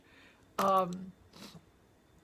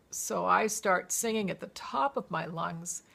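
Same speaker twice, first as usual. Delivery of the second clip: ordinary delivery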